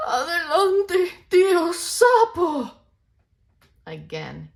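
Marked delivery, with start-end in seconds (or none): 0.1-2.7 high, loud, slow and breathless; tired deer calling one last time; gasping call. Acted. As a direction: force it out while winded. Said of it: "Venado gasped".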